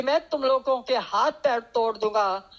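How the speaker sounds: usual speaking voice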